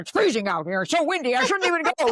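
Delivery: a petulant ego griping about going out; whiny ego voice. Retold or imitated. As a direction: complain about the cold like a spoiled brat. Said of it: "We're like".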